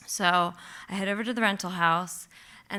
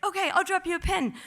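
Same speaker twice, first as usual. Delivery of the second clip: quick chirpy reply; 11 semitones higher, faster than usual. Retold or imitated. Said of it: "And I was like".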